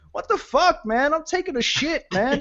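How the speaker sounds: high-pitched mock Southern American accent, annoyed; Southern American stranger in a toilet stall, high mock annoyance. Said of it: "like, Southern American".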